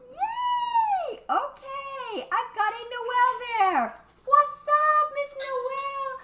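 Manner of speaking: squealing high-pitched hello, slow and sing-song; gushing greeting. Real, not acted. Gushing over viewers joining the live stream, squealing with delight.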